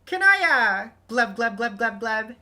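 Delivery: high, loud burbling glubs; gurgling fish man croak. Acted. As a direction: bubble out fishy glub sounds. Fish troll girl glubs.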